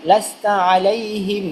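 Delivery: drawn-out reading of লাস্তা আলাইহিম; slow recitation. Shown as a demonstration. Teacher to students, joining the spelled word, slow recitation. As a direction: stretch লাস্তা আলাইহিম syllable by syllable. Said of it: "teacher demonstrates Quran word".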